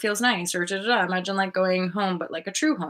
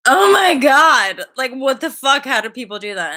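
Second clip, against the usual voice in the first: loud, higher, imitating a stunned empath; mock outrage, louder and higher; shocked outburst; 6 semitones higher, 8 dB louder than usual. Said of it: "many empaths have come out being like".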